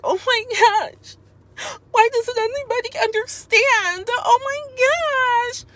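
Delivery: very high, slow mock wail; imitating Alicia's type. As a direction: whine in drawn-out, exaggerated despair. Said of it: "relate to Alicia's type".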